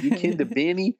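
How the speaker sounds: high Texas twang, amused. Retold or imitated. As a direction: mimic a Texan professor's twangy question. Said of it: "in that Texas twang".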